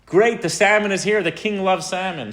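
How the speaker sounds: hammed-up cheerful guard; excited guard. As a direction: greet the salmon like great news. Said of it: "he says".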